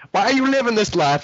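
high-pitched mock questioner; hammed-up high voice; acting out song character. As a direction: play the man's question in a put-on high voice. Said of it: "that he's like".

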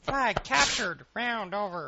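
slow high announcer call; game announcer. Retold or imitated.

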